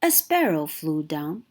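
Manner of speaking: ordinary delivery, nothing marked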